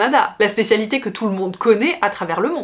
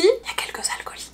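whispered, higher jab at a friend; teasing whisper; 10 semitones higher, 6 dB quieter, faster than usual. Real, not acted. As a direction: slip in a cheeky whispered aside. Chuchote une pique à l'ami. (chuchotement taquin à l'ami)

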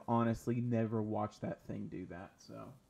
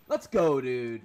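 hyped cheer; 11 dB louder, much slower than usual. Real, not acted. Celebrating the kill.

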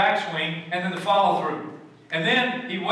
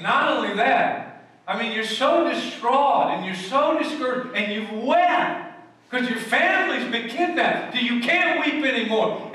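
full-force preaching; 5 semitones higher, slower than usual. Acted. Preacher to his congregation, booming and raised. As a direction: proclaim it to the whole congregation. Preacher pressing emotional point.

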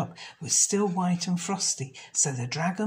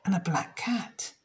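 ordinary delivery

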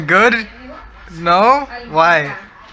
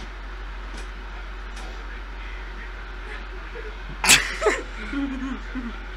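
wheezing laugh; 8 semitones higher, 9 dB quieter than usual. Real, not acted. Brother bit the sour candy.

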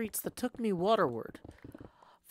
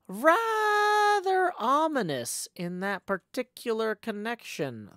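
taken aback; 3 semitones higher, 5 dB louder than usual. Acted. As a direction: stretch "Rather" out in mock alarm. Uneasy thought about the sign.

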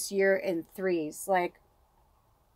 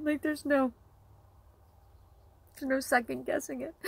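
tearful; 5 semitones higher than usual. Real, not acted. Grieving her aunt on camera.

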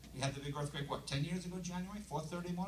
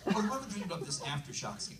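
ordinary delivery

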